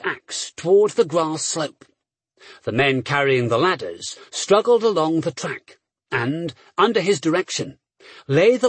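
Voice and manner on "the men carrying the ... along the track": plain reading voice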